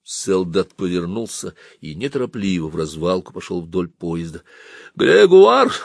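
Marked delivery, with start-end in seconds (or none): 5.0-5.7 high, loud call with a drawn-out name; shrill-pitched shout; old woman's cry. A narrator's reading. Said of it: "крикнула старуха".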